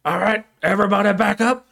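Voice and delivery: high, loud hostage-taker's order; mock threat. Sheriff holding himself at gunpoint, mock threatening shout. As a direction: order the crowd back.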